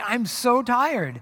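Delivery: slow, drawn-out I'm so tired; mock exhausted complaint, slow; weary sigh. Shown as a demonstration. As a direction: let the tiredness drag every word. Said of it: "several of those discussions lately".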